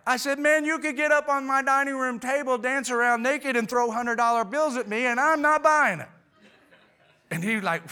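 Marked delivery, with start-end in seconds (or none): 0.4-6.1 high, loud, hammed-up protest; emphatic refusal. Homeowner rejecting the salesman's cash offer, emphatic refusal. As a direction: exclaim it, building to a final punch.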